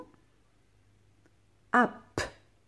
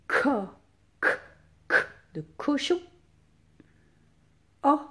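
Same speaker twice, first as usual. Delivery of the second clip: ordinary talk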